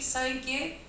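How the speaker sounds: ordinary talk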